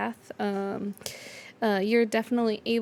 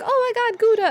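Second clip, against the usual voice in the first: very high, louder squeal of joy; overjoyed cry; 12 semitones higher, 8 dB louder than usual. Acted. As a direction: squeal on hearing Gouda again. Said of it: "And Dory's like".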